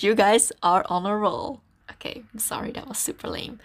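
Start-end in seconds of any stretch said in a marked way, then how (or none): 1.9-3.6 embarrassed whisper. Real, not acted. Embarrassed after bad pun.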